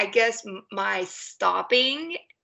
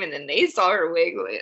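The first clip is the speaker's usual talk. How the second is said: normal talking voice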